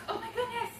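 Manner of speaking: high-pitched coo; excited baby talk. Shown as a demonstration. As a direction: gush at the dog in a high voice. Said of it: "usually baby talk".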